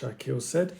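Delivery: usual speaking voice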